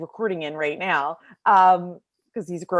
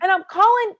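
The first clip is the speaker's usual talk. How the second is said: high loud frantic shout; panicked husband shouting; husband yelling; 12 semitones higher, 6 dB louder than usual. Retold or imitated. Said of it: "he starts screaming".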